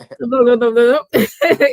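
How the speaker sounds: mock tongue-tied gibberish, laughing; tongue-tripping babble. Shown as a demonstration. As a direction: trip over your own tongue, jokingly. Mimics her tongue tripping over publicity.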